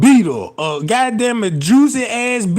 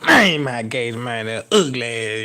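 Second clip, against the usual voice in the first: much lower mock mutter; rueful regret; 9 semitones lower than usual. Man regretting it after, lower mutter. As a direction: groan it out in regret. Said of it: "Be like".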